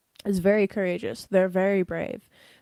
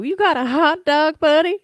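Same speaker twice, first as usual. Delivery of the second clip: much higher and louder, gushing at the dog; loud high-pitched baby talk to a wiener dog; excited dog owner; 9 semitones higher, 10 dB louder than usual. Retold or imitated. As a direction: squeal it like greeting a happy puppy. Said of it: "This is you".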